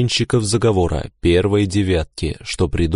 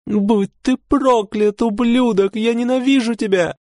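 furious weeping woman; hateful cry. A narrator's reading. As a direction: spit the curse through tears. Woman attacks with a dagger.

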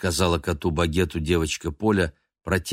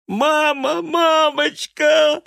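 much higher, louder wailing cry; sobbing child; 7 dB louder, much slower than usual. A narrator's reading. Polya running in crying for her mother. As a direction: wail for mother. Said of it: "рыдая".

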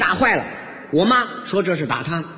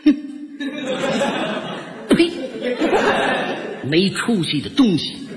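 contemptuous mother; 3 semitones higher than usual. Retold or imitated. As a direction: spit out disgust at the son. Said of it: "在后边恶狠狠地瞪了我一眼".

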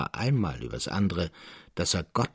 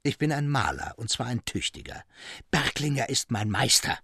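angry shouting; 4 semitones higher than usual. A narrator's reading. Traugott to Elias Roos, furiously quitting the office. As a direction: hurl it at the old merchant in rage. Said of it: "schrie er".

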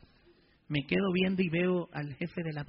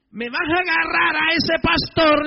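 loud, high and slow, raging order; retold furious priest giving orders; furious command; 9 semitones higher, 13 dB louder, slower than usual. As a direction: bark the order at the gang leader. Priest retells ordering gang leader.

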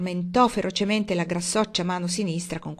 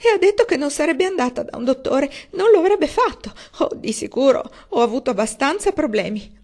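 much higher, louder tearful moan; wailing; 7 semitones higher, 7 dB louder than usual. A narrator's reading. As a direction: moan it in despair. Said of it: "gemette missis Frederick". (lamento acuto e disperato)

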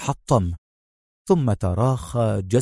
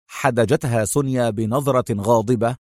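ordinary delivery

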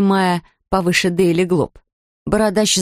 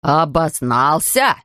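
mild mock roar; 4 semitones lower, much slower than usual. A narrator's reading. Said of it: "взревел бородач".

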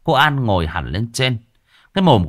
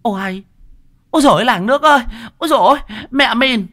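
screaming falsetto cry for help; hue and cry; 5 dB louder than usual. A narrator's reading. Woman alerting villagers to a kidnapper, frantic hollering. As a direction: shout to the whole village, very high and loud. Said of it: "tự dưng hô hoán".

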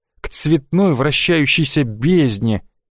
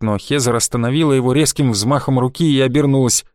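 usual speaking voice

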